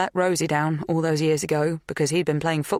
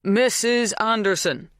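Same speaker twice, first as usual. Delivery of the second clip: slow, higher-pitched, spelt-out name; slow enunciation; 7 semitones higher, 3 dB louder, slower than usual. A narrator's reading. Bernard prompting a Polish farmhand, slowly enunciated. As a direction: say it slowly for a foreigner.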